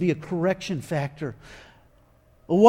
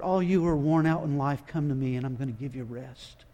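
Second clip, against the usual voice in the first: soft, lower and soothing; quietly reassuring promise; comforting voice; 4 semitones lower, 7 dB quieter than usual. Shown as a demonstration. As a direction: invite the weary in gently. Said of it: "It's a language of comfort".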